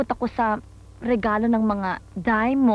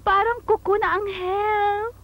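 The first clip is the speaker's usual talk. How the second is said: simpering sweetness; 7 semitones higher, slower than usual. Acted. Simpering sweet daughter to Mother.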